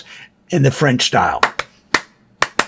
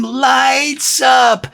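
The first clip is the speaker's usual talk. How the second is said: high shouted Lights up; crowd scream; 7 semitones higher than usual. Retold or imitated. The town at the bridge cheering the lights, high shout. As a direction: yell it like the whole town at once. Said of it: "would just scream".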